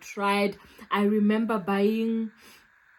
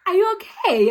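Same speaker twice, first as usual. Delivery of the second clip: concerned lady; 10 semitones higher, 8 dB louder than usual. Retold or imitated. Voicing the helpful neighbour lady.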